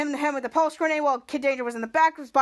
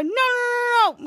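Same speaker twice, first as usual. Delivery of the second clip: high, run-together string of protest; hammed-up panicky cry; acted alarm; 9 semitones higher, 3 dB louder than usual. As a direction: plead in mock panic, pitch jumping up. Panicked reaction to girl jumping.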